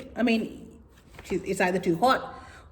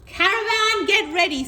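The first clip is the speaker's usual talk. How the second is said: calling leader; 10 semitones higher, 4 dB louder than usual. Acted. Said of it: "said the leader".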